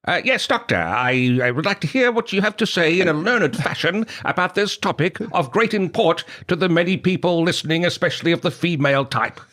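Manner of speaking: put-on high old-time radio announcer voice; 1940s voice. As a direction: play a stiff, learned 1940s gentleman. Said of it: "Do you have a nineteen forties voice that you can do?".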